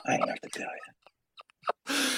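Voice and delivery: put-on slow, conspiratorial whisper of an old politician; Joe Biden. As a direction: drop suddenly into a drawn-out whisper. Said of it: "that's my Biden".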